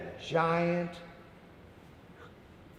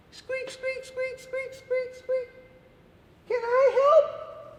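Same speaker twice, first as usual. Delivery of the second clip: very high, small squeaky mouse; tiny mouse voice; slower than usual. Acted. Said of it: "a little mouse came by".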